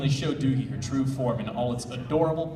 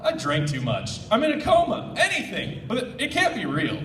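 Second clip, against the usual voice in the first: disbelief; 7 semitones higher than usual. Acted. Disbelief, acted quote.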